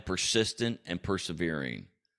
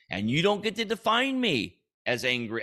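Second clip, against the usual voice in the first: indignant, higher-pitched protest; heated self-defence; 7 semitones higher than usual. Acted. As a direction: push back with pitch raised. Mock-heated defence against being called angry.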